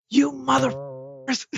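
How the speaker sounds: high, slow mock-angry curse; retold furious mom. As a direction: voice the livid mother swearing at the kids. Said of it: "like, so pissed".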